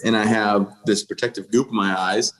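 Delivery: ordinary talk, nothing marked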